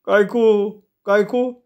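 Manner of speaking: put-on high voice of a suspicious boy; nervous, suspicious. A narrator's reading. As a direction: ask it fearfully, pitched right up. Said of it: "घाबरी संशयी नजर ... फिरवत तो म्हणाला".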